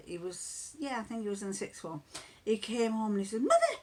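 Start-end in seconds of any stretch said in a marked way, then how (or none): 3.4-3.7 high, loud mock-excited son calling Mother; teenage son bursting in with news; mimicked son. Son excitedly reports colourblind news.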